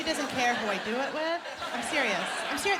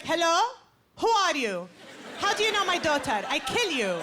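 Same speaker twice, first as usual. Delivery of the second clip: menacing dad; 4 semitones higher than usual. Retold or imitated. Dad answering a boy calling for his daughter, mock menacing. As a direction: bark at the boy who dared call.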